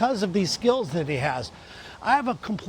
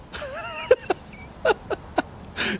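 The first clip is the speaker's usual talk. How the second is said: airy laughter. Real, not acted. Laughing at the friend's bad math.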